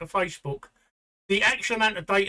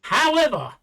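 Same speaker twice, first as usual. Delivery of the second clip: loud, high insistent however; emphatic; 8 semitones higher, 7 dB louder than usual. Real, not acted. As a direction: cut back in firmly. Insists over interruption.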